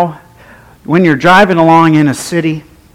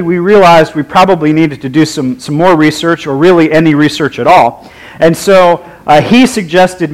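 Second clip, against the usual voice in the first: ordinary talk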